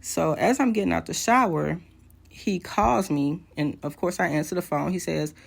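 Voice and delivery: ordinary talk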